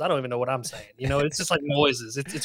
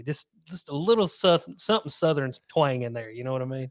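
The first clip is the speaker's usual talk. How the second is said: ordinary delivery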